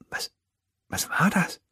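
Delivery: leise, hohe, stockende Frage; wispernd. A narrator's reading. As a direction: frag vorsichtig wispernd. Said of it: "wispert Tafiti". (leise wispernd)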